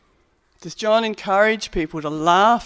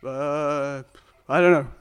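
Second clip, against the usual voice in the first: lower, vague and clueless; vague healed man; 4 semitones lower than usual. Acted. Healed man telling authorities, clueless. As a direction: shrug it off dimly. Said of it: "the religious authorities see him".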